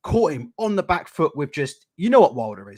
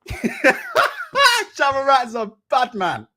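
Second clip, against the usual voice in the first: higher, slow, laughing dancehall hype; 8 semitones higher, 4 dB louder, much slower than usual. Real, not acted. Streamer hyping [name] to chat, slow and laughing. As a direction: belt the name out, drawn out. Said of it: "me screaming [name]".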